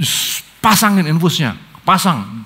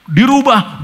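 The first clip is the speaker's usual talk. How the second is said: ordinary delivery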